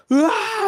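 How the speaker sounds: high mock scream of effort; a short yelled Ah, way up high; imitated yell. Fan imitating John Wick breaking the cement, a high mock yell. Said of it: "And he, like, yells".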